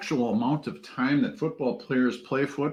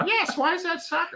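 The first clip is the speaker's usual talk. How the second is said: put-on high, laughing child voice; imitating a confused kid. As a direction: squeak it out like a puzzled little boy. Son keeps asking about soccer.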